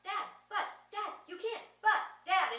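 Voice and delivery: high, loud, pleading sputter; stunned protest. Retold or imitated. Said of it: "the son just goes".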